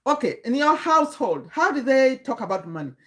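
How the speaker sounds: ordinary delivery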